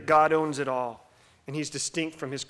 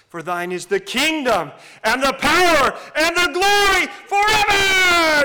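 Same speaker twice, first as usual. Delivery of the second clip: prayer building to an all-out roar; swelling full-volume cry of praise, last word stretched long; building passionate shout; 12 semitones higher, 13 dB louder than usual. Retold or imitated. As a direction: shout it higher and louder with each phrase. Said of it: "raised in intensity and volume and passion".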